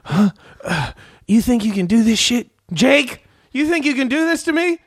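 high, heated tough-cop rant; Training Day cop threatening the room; Denzel rant impression. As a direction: menace them like a dirty cop losing it. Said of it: "Denzel Washington's character".

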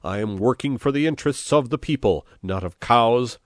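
angry, high-pitched loud outburst; bawling. A narrator's reading. Chief ranting about the cow, bawling. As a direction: keep bawling, loud right to the last word. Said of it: "bawled Pepone".

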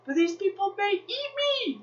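high, wailing panic; mock terror. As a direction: whimper it in exaggerated fright. Acted fear of strangers.

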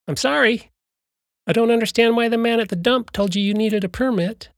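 high, loud shrill voice of a snooty clerk. Acted. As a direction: raise a shrill voice after them. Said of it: "The man's shrill voice raised".

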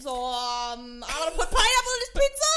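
put-on high, loud Brooklyn accent. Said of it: "this Brooklyn Italian part Japanese person".

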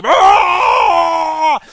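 long, loud, high roar of pain; pained monster scream. A narrator's reading. Monster raising its club, painful scream. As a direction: scream it out at full force. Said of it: "screaming painfully".

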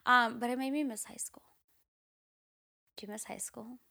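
unvoiced whisper, half to herself; a soft nostalgic whisper; whispered aside. Real, not acted. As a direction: breathe it out as a wistful whisper. Wistful aside about missing school.

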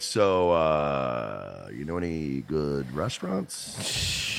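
smooth pickup line. Retold or imitated. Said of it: "You're like".

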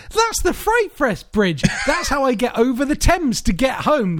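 high, thrilled realisation; excited recognition. Retold or imitated. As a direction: burst out as if spotting a familiar place. Acted excited recognition while watching.

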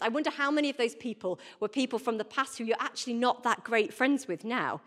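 normal talking voice

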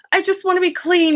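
high plaintive mock plea; imitating pleading mother. Voices mother pleading to bathe.

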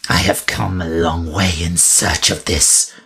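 understated hissing intensity; mild hiss. A narrator's reading. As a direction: press it on him, hissing. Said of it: "she hissed".

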